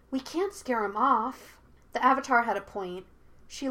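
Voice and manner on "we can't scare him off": ordinary delivery, nothing marked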